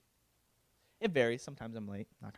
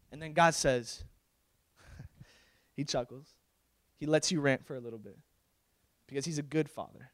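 usual speaking voice

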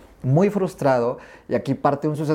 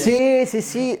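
excited agreement; 10 semitones higher, much slower than usual. Real, not acted. Answering the host's joke, an excited high-pitched yes. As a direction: pitch jumps way up on an eager yes.

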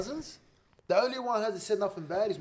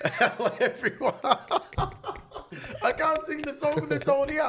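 giggling high through the greeting; can barely get the words out for laughing; 10 semitones higher, 4 dB louder than usual. Real, not acted. Host laughing through the podcast intro.

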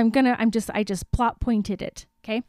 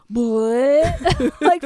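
ordinary talk, nothing marked

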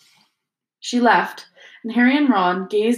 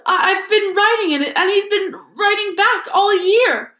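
put-on much higher, louder tearful girl voice; sobbing; 12 semitones higher, 5 dB louder than usual. Ginny confessing the diary wrote back, sobbing. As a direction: stammer it out through tears. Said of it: "Ginny sobbed".

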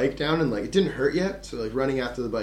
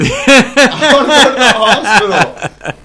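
high, loud, slow laughing protest; half-laugh, half-shout at the absurd driver; laughing exasperation; 11 semitones higher, 13 dB louder, slower than usual. Retold or imitated. Injured man tells the driver it's no taxi, laughing loud protest.

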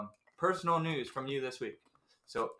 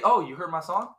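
raised, louder, rising question; pitched-up, eager mock question; overeager asking; 4 semitones higher, 9 dB louder than usual. Shown as a demonstration. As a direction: ask brightly, as if surprised they heard it. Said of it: "don't say it like that".

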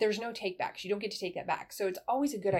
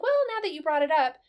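louder, higher, quick husband admission; ominous husband reply; 8 semitones higher, 6 dB louder, faster than usual. Retold or imitated. Wife acting out husband's guilty reply, louder, higher and quick. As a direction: blurt out the husband's uh-oh opener. Husband voiced, confession opening.

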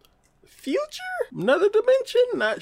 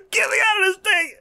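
high, fast mock yell; imitating a trapped man yelling for help; trapped panic; 4 dB louder, faster than usual. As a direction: plead frantically to be let out.

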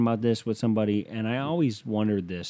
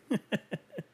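high laugh; 14 semitones higher, 5 dB quieter than usual. Real, not acted. Laugh after sarcasm.

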